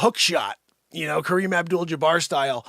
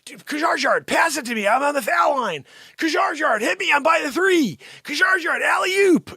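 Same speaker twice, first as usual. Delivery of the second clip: high, loud court shouts; calling for the ball; 9 semitones higher, 4 dB louder than usual. Retold or imitated. Player on the court calling to teammate Kajgaard, high loud shouts. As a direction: yell for the pass across the court. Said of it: "you're out playing basketball with Kajgaard".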